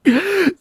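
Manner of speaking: high sharp gasp of fright; startled gasp. A narrator's reading. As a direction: gasp as if jumped at. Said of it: "I squeaked out".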